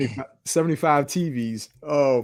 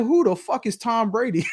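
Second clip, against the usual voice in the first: laughing imitation; 7 semitones higher than usual. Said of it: "He said".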